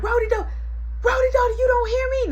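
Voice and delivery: high, loud calling name; mom calling. Retold or imitated. Mom calling the missing kid, high and loud. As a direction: call for the hiding kid. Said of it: "my mom be like".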